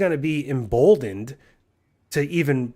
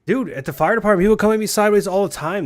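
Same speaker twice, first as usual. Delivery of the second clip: louder and higher, fired up; heated complaint; 6 semitones higher, 6 dB louder, faster than usual. Real, not acted. Complaining to a gaming friend about firehouse coworkers, heated. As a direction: push it out, fired up.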